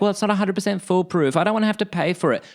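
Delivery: high, mock whiny excuses; imitating an excuse-maker. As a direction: parody someone making petty excuses. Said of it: "Don't give me excuses like".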